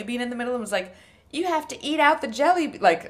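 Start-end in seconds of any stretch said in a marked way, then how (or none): 1.3-2.8 mock Southern host voice, pitched up; imitating the host in a Southern accent; weird Southern accent. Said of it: "weird Southern accent".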